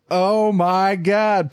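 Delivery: high, very slow amazement; awed exclamation. Retold or imitated. New dad as the baby flies out, drawn-out awe. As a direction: stretch it out in stunned wonder.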